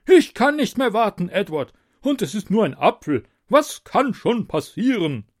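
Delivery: high, loud impatient king; impatient tyrant king. A narrator's reading. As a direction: brush off the warning greedily. King voiced by narrator.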